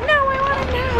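put-on high whining kid demand; a whiny toddler insisting; whiny child. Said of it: "he was like".

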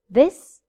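This short and rising, quick ɪ; short vowel in This. Shown as a demonstration. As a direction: clip the i in This short. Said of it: "Listen to the difference".

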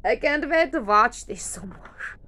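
loud gushing fan squeal; excited. Real, not acted. Fan gushing about watching the concert, loud and thrilled. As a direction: burst with can't-wait glee.

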